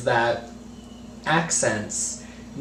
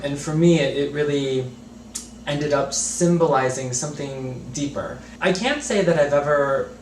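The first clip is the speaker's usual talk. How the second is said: ordinary talk, nothing marked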